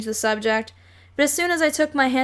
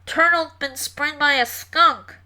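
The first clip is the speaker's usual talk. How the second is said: held-nose voice; 4 semitones higher than usual. A narrator's reading. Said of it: "sounded as if they had the worst colds".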